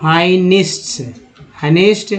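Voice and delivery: ordinary talk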